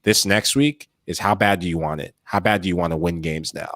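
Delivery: normal talking voice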